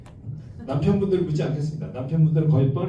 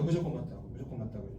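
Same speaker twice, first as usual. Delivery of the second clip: 무조건 said much softer and lower, fading out; fading repeat; 7 semitones lower, 10 dB quieter, faster than usual. Shown as a demonstration. Lecturer to audience, repeating the rule while fading. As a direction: let 무조건 맞다고 die away to a murmur. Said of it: "무조건 뭐예요?".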